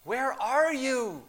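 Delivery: high, drawn-out pleading call; acted anguish, voice lifted. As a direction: call out slowly in pained longing. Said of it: "asks in anguish".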